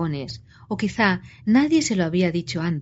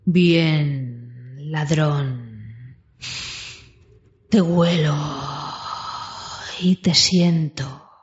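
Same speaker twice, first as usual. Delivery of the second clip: low, slow menacing dragon; 4 semitones lower, much slower than usual. A narrator's reading. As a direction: purr it like a dragon. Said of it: "Entonces Smaug habló".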